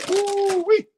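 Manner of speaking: high loud woo-wee; excited whoop. Real, not acted. Reacting to strong cheese flavor.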